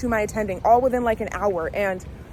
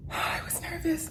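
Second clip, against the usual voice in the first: whispered confession; 8 semitones higher, 10 dB quieter than usual. Real, not acted. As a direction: whisper it, high and soft. Confessing her nerves after presenting.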